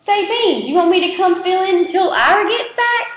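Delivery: put-on eager kid. A narrator's reading. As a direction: pitch yourself for the job. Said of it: "another one offers".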